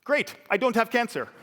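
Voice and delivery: 高く大きく、元気いっぱいに言い切る; 病気を笑い飛ばす父の明るい声; dad's cheery defiance. Retold or imitated. Dad's defiant upbeat reply.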